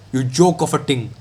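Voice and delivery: ordinary talk, nothing marked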